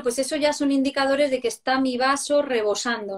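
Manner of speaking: ordinary talk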